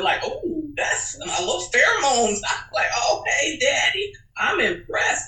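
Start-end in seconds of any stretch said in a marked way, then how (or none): 0.3-2.4 high, sultry playful purr; mock-seductive, aroused purr. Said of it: "an [name] purr". 3.1-5.3 turned on. Retold or imitated. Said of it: "That turned me on".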